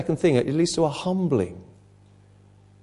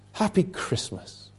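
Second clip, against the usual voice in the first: slow, much softer, dry aside; deadpan joke to the congregation; wry irony; 5 semitones higher, 8 dB quieter, slower than usual. Real, not acted. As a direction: ironic Happy Christmas, slow and hushed. Ironic aside about repentance.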